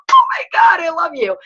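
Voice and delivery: high, loud, gushing shriek; overjoyed outburst. Retold or imitated. Daughter answering her mom's rare praise, gushing squeal. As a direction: squeal it like you just got the best compliment.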